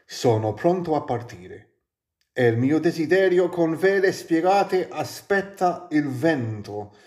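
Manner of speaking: measured, lyrical reading of Italian prose; Italian poetry recital; poetic reading. Acted. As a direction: read it like a solemn poem. Reads Gibran aloud in Italian.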